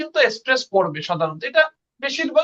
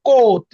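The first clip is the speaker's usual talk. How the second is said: কোট said loud with a clear ও; teacher demoing a stressed কোট; 8 dB louder, much slower than usual. Shown as a demonstration. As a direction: hit the ও of কোট. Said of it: "ও কিন্তু বেশি জোর পড়তেছে".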